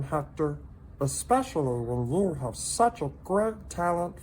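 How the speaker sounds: high little creature voice, gently pitying; a tiny pet breaking hard news kindly; sympathetic salamander voice. A narrator's reading. Minton consoling lonely Hector, high sympathetic voice.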